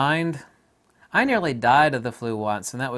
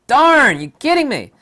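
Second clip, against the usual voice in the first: loud, much higher, mock astonished; disbelieving outburst; 12 semitones higher, 11 dB louder than usual. Hearing Victoria news, shouting loud high disbelief. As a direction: blurt it out as if you can't believe it. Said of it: "I'm like".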